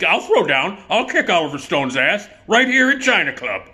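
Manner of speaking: crank it up high for a fight; Joe Donatello. Acted. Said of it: "You know, Joe's".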